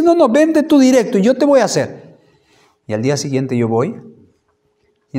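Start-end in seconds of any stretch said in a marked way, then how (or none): 0.0-2.2 much higher and louder, retold doctor's offer; eager doctor. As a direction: play up the doctor's surprising eagerness. Doctor offers direct help.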